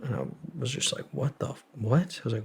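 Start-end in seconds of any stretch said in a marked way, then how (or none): 1.1-2.2 retold confusion. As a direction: replay being thrown, pitched up. Retelling own confusion at agent.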